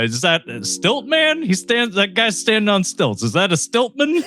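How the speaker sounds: high mock-dad puzzling; imitating dad. Host mimics his dad spotting Stilt-Man, high and puzzled.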